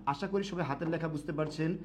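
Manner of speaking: ordinary talk, nothing marked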